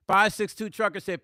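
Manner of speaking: ordinary delivery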